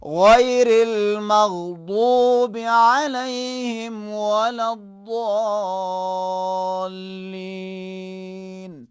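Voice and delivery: slow loud recitation, ض heavy; tajwid demo. Shown as a demonstration. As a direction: recite غیر المغضوب slowly with a heavy ض. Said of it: "وہ کس انداز میں؟".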